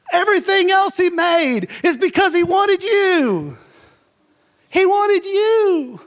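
high, loud, near-tearful cry of joy; excited hollering. Real, not acted. Preacher to congregation about God wanting them, hollering with joy. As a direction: holler it out with overflowing joy, not anger. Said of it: "I'm not hollering 'cause I'm mad. I'm excited".

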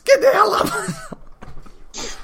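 put-on high, slow cranky old man yell; angry old man. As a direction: shoo them off your porch. Plays angry old homeowner.

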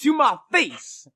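high, indignant mock protest; Jesse. Friend denying threats against his boss, high hammed-up indignation. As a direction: imitate a blustering friend, emphatic and worked up. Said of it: "You're like".

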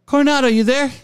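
high, bright on-air call; mock radio host. [name] calling the wrong name on air, mock host call. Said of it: "Steve Coronado".

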